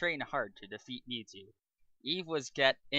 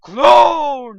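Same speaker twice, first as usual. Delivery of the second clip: much louder, higher shouted reveal; dramatic reveal; 12 semitones higher, 24 dB louder than usual. Acted. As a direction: blast the twist out after the pause. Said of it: "Pause for emphasis".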